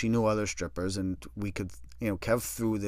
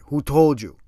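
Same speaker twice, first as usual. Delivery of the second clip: louder, pressing question; urgent demand; 3 semitones higher, 8 dB louder than usual. Retold or imitated. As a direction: demand an answer fast. Kev demands source.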